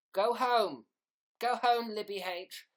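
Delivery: mock scolding shout. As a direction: playfully order a drunk viewer away. Mock scolding a drunk viewer.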